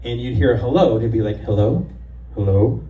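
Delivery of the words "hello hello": low, gruff guy on the line; deep caller voice. Retold or imitated. Caller on the chat line answering, in a low voice. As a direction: drop low like a butch caller.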